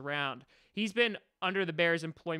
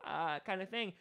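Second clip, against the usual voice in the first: ordinary delivery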